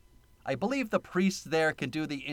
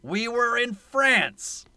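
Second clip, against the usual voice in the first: slow, higher, louder mock delivery; a hammed-up movie quote; Ron Perlman line; 8 semitones higher, 4 dB louder, much slower than usual. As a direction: ham up the line slowly. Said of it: "And Ron Perlman just says".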